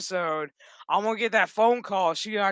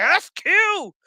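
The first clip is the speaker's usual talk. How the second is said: very high, hammed-up yelp; squealed comic exclamation; mock alarm; 11 semitones higher than usual. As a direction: imitate a frantic outburst, pitched way up. Repeats Picard's outburst, exaggerated.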